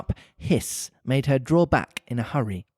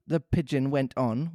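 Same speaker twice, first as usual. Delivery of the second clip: plain reading voice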